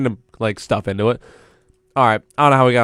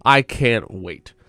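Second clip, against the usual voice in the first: usual speaking voice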